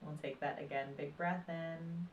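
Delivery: calm, unhurried meditation voice with long pauses; guided meditation. Acted. Meditation teacher guiding listeners through breath and body awareness, calm guided meditation.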